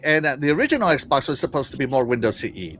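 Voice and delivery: normal talking voice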